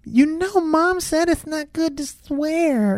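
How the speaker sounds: put-on very high, slow little girl voice. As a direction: imitate a small child scolding Daddy. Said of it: "she's like".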